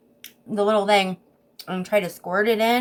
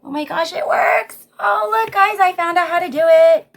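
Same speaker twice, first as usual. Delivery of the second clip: very high and loud delight; excited squeal; 11 semitones higher, 9 dB louder than usual. Real, not acted. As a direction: squeals with discovery. Discovers the trick works.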